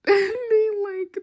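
high, loud laughing squeal; laughing hard. Real, not acted. Retelling the cook's reaction, laughing loudly. Cracking up at the story.

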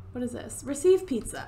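normal talking voice

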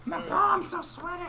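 shouting. Pretend. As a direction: belt it out as a joke. Meme quote shouted.

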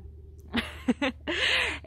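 high giggle; real high, amused laugh. Real, not acted. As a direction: giggle at your own joke. Laughs after joking about getting guys.

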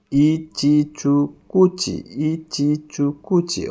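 slow, drawn-out "ইচিচুকুচি", every c as ch; C pronounced ch. Shown as a demonstration. Teacher showing how they say C, slow "ইচিচুকুচি" with ch. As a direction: stretch "ইচিচুকুচি" syllable by syllable with ch sounds. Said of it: "ওরা সিরে চ উচ্চারণ করে".

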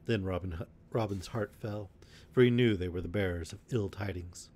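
ordinary delivery

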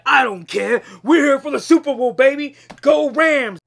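loud, fast, pumped-up yell; hype shout. Retold or imitated. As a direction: shout it like a fan. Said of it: "I say".

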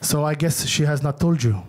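put-on low, slow deadpan uncle with an accent; imitating uncle. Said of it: "He's like".

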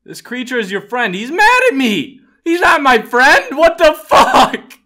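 very high, loud panicked shouting; panicked yelling. Real, not acted. Gamer fleeing an angry monster, panicked yelling. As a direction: yell in real panic as it comes at you.

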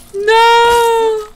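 high, loud cry of no; a sharp horrified no; anguished no. Real, not acted. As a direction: cry out in disbelief. Father just killed.

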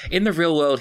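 ordinary talk

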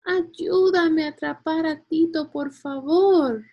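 high, loud cry for help; high-pitched storybook girl pleading loudly; shouted plea. A narrator's reading. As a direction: call out urgently, dragging out the please. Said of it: "gritó Julia".

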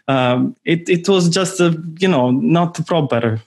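hammed-up "mamma mia" exasperation; Italian spy phoning home. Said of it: "the spy is, like, calling back, uh, home".

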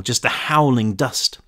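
ordinary delivery, nothing marked

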